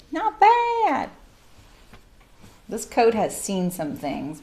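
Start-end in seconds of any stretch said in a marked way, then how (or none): 0.1-1.1 much higher, delighted little not bad; pleased squeak. Real, not acted. Sewing crafter admiring her finished hem, high pleased squeak. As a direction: squeal it at your own neat seam.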